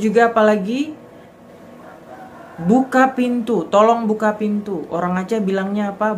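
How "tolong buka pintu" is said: usual speaking voice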